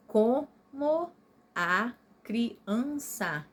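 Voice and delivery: COMO A CRIANÇA broken apart, co, mo, a, cri, an, ça; slow, separated beats on every syllable of COMO A CRIANÇA. Shown as a demonstration. Teacher before joining the sounds, COMO A CRIANÇA split into syllables. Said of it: "eu vou juntar".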